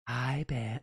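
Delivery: a low, half-hearted witch; lightly voiced witch, pitched low; attempted witch's voice. Host reading fan letter aloud, failed witch voice. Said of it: "read this in a, a witch's voice".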